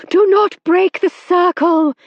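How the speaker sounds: high, loud commanding shout. A narrator's reading. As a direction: shout over the hubbub. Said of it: "shouted Madame Eugenie".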